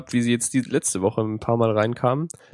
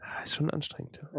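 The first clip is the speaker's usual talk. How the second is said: whispering; 4 semitones higher, 14 dB quieter than usual. Acted. Zustimmendes Flüstern.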